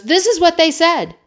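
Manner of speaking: high, loud retold disbelief; stunned. Son phoning parent about the remark, stunned imitation. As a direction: imitate an outraged son reporting the insult. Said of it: "he was genuinely stunned".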